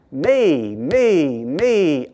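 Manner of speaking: Me, me, me pounded out in rhythm; mock the man's selfishness by hammering each me; jackhammer pronouns. Shown as a demonstration. Pastor mocking the rich man's self-focus, hammering each word. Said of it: "like a jackhammer".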